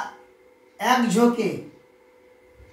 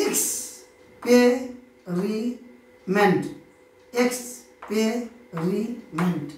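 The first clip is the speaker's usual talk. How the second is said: "Experiment" very slowly as এক্স-পে-রি-মেন্ট; four separated beats in "experiment", pausing after each; split into four syllables; much slower than usual. Shown as a demonstration. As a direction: sound out "experiment" one syllable at a time. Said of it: "চার শ্বাসে বা ঝোঁকে".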